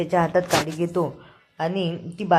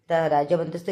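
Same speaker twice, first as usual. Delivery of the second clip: ordinary delivery, nothing marked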